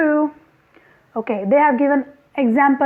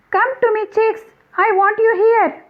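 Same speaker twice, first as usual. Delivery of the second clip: high calling mother hen; 8 semitones higher than usual. Acted. Teacher voicing the mother hen calling her chicks. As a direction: call the chicks in like a fussing hen. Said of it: "Who told like that? Mother hen told".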